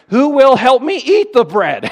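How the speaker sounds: loud, high, slow hen question; storybook hen asking. Acted. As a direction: call to the friends as the little hen. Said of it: "tired little red hen asked her friends".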